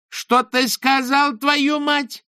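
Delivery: very high, slow outraged yell; outraged shriek; furious shout. A narrator's reading. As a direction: scream it in disbelief. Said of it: "крикнула Берил".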